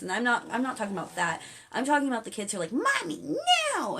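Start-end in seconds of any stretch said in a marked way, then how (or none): 2.7-4.0 high-pitched whiny kid imitation; mock a spoiled child defying mother; bratty child. Imitating bratty kids to viewers, whiny child voice.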